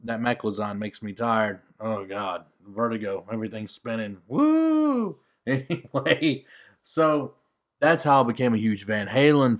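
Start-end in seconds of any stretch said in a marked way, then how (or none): 4.3-5.2 dizzy whoop. Real, not acted. Dizzy from vertigo medicine.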